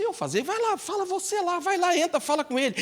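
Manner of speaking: very high voice, urging him on; pushy disciple. Acted. The disciple tells the thief to go in, high and insistent.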